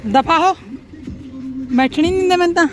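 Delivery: loud, high mock complaint; playful loud scolding. Real, not acted. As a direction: shoo them off in jest. Playful scolding over car seat.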